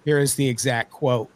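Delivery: usual speaking voice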